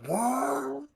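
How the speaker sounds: high loud vocal whoa; mock revving sound. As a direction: rev it up with your voice. Chainsaw starting up.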